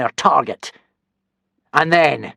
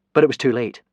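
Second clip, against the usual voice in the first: plain reading voice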